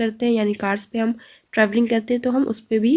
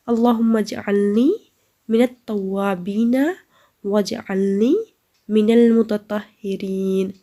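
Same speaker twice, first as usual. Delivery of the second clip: slow, louder measured recitation; recitation for students; 3 dB louder, slower than usual. Shown as a demonstration. Teacher reciting the dua for the class to repeat. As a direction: lead the class through it clearly. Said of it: "So recite this dua with me once more".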